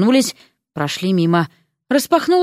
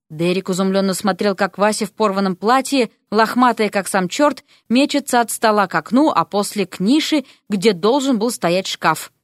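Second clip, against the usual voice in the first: ordinary delivery, nothing marked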